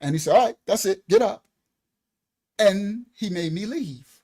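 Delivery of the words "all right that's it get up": louder, firm send-off; firm dismissal. Retold or imitated. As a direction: put a stop to it, firmly. Doctor ends visit.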